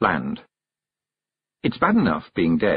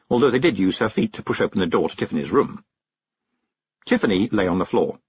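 usual speaking voice

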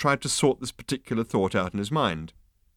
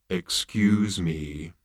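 mournful robot; 2 semitones lower, much slower than usual. Acted. Gloomy robot interrupting the insect, very slow. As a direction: drag it out with utter gloom. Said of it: "in a voice that would have made an insect".

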